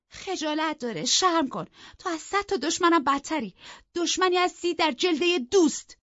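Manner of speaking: high, loud reproach; angry. A narrator's reading. Isabella shaming her sister-in-law as false friend, angry. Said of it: "با عصبانیت گفت".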